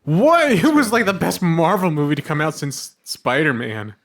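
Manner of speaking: high, loud, incredulous cry; indignant outburst. Real, not acted. Friend leaping to defend a Marvel movie, loud indignant outburst. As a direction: burst out loudly in outraged disbelief.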